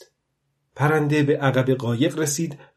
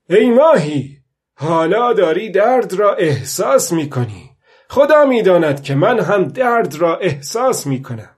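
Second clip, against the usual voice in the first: put-on old fisherman calling out; 5 semitones higher, 7 dB louder than usual. A narrator's reading. Old man tells the fish he feels pain too, loud call. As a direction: call out to the fish as a fellow sufferer.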